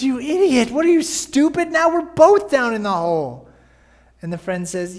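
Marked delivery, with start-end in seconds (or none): 0.0-3.5 loud, much higher mock outrage; exasperated scolding. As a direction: berate him like an exasperated buddy. Friend scolds the man.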